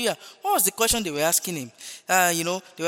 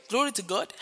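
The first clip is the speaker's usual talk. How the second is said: ordinary delivery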